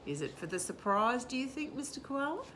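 ordinary delivery

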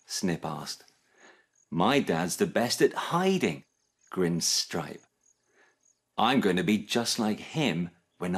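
animated storytelling with lively character voices; children's story reading. A narrator's reading. Narrator telling children about Snip's dad, animated storytelling. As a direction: read a picture book aloud with expressive swoops. Reads a picture book to children.

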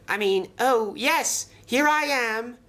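high-pitched, flustered correction; flustered relief. A narrator's reading. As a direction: recover from shock into high, eager relief. Startled Toad realises he is rescued.

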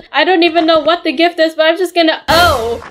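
ordinary talk, nothing marked

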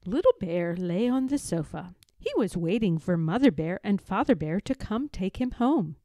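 plain reading voice

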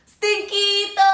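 high, loud, sung-out call. Acted. Kitty boy calling to his hidden dog friends, sung-out call. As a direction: call it out across the jungle. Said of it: "called out Skippy John Jones".

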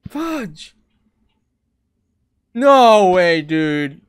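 loud exasperated outburst; frustrated shout. Real, not acted. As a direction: yell it in disbelief. Blocked again while playing.

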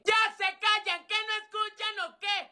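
high-pitched exasperated teacher; teacher scolding. Acted. Teacher quieting unruly pupils, scolding. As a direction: call for order in a high voice.

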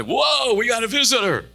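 high, bright mock cheer of the congregation; excited church folks. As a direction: burst out like thrilled church members spotting a visitor. Congregation greets a newcomer.

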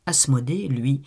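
ordinary delivery, nothing marked

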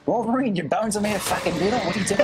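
Magneto voice. Pretend. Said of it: "Magneto lines, go".

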